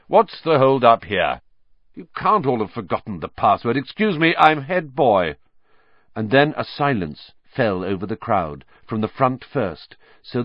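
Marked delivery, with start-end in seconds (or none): 0.1-5.4 put-on self-important, slowing on head boy. A narrator's reading. Head boy demands the hold-up, self-important and loud. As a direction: lord it over the crowd. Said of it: "bustling importantly through the crowd".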